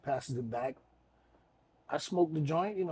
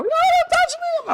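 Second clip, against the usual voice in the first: very high mock scream; panicked shriek impression; 4 dB louder than usual. Man imitating the woman as he touches her arm, high panicked shriek.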